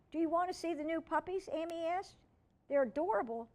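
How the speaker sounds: put-on high eager little girl voice; bubbly young girl asking.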